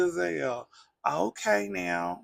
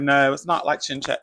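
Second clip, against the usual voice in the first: louder, emphatic pushback; firm loud denial; 7 dB louder than usual. Retold or imitated. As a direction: push back hard, raise your voice. Tia pushing back firmly.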